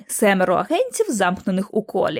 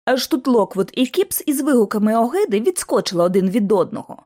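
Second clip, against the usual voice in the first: usual speaking voice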